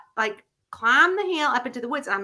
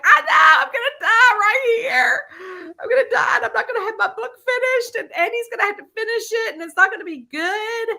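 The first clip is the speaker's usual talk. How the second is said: high, loud, half-laughing fake sob; comic wail of doom, laughing through a fake cry; mock panic wail; 12 semitones higher, 6 dB louder than usual. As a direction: ham up dying of fright. Retelling panic to Carolyn.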